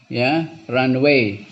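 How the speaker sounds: normal talking voice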